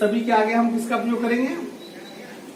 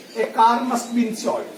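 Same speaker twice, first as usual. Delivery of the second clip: slow example read; much slower than usual. Shown as a demonstration. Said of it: "reads example sentence slowly".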